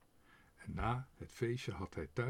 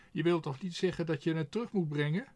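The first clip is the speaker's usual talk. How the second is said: higher, louder, fast frightened question; alarmed crow; 6 semitones higher, 6 dB louder, faster than usual. A narrator's reading. Quark fears he must return the money, fast alarmed voice. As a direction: blurt it out in alarm. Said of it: "vroeg Quark verschrikt".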